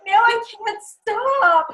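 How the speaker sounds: high, slow mock-crying wail; play-acting tears you can't stop; mock sobbing. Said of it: "I'm not crying".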